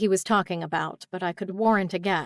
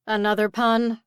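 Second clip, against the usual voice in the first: ordinary delivery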